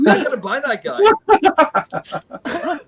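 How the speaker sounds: high, very slow silly voice; goofy toy voice. Pretend. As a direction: stretch it out like a cartoon toy. Goofing with toys.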